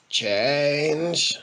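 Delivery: a hint of a slurring old drunk; slurred spitty drunk. A narrator's reading. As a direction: slur it wetly like a drunk. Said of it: "pronounced with much spittle and slurring".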